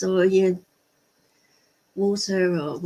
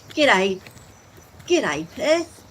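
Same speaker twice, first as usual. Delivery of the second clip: amateur Australian accent, second greeting rising higher; 3 semitones higher, 4 dB louder, much slower than usual. Shown as a demonstration. English vlogger greeting Perth viewer, attempted Australian accent. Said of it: "That's my best Australian accent".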